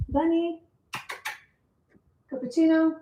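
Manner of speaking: calling the cat. Real, not acted. Calling softly for missing cat.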